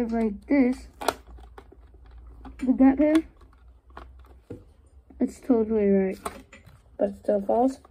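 muffled behind face mask; speaking into pilot mask; through an oxygen mask. Real, not acted. Seen 4: green oxygen mask strapped over mouth and nose throughout.

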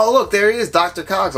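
imitating wife's high-pitched delight; excited spotting. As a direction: pipe up as if spotting a favorite actor. Voicing wife spotting a favorite actor.

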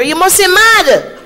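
high, loud, slow outraged shout; shocked shout. Retold or imitated. Auntie yelling at the young man asking to marry her niece. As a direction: bellow it in disbelief. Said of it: "I only hear".

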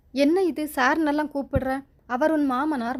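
ordinary delivery, nothing marked

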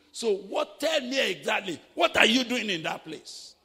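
loud demanding shout; shouted challenge. Acted. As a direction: confront him hard, demanding an answer. Preacher voicing colleague's reproach.

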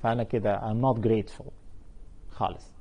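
ordinary delivery

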